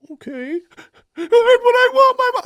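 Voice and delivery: high, loud mock wail; screaming child. Nephew pitching a fit for mom, imitated screaming child. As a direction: imitate a bawling kid wanting a parent.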